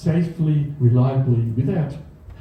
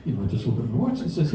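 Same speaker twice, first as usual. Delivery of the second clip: ordinary talk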